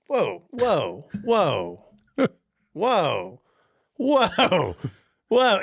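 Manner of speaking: retold shock, each whoa rising; stunned whoa. Retelling the coworker's answer to a friend, shocked whoas building up.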